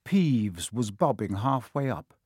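ordinary delivery

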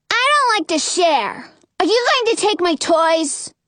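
put-on high-pitched, possessive child voice; suspicious little boy. A narrator's reading. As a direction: challenge him like a wary small kid. Suspicious little boy confronts newcomer.

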